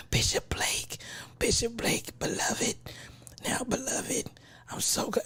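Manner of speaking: mock whispering preacher; Bishop Blake. Teasing about church bishop with the whisper, mock whispering. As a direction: imitate a voiceless whispered sermon, very soft.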